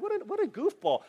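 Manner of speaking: a squeaky mock cry of disbelief; high mock disbelief; mocking, jumps way up in pitch. Real, not acted. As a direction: tease him as a goofball, pitched high. Mocking Abraham.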